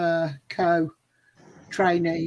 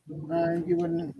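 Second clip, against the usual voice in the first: ordinary talk, nothing marked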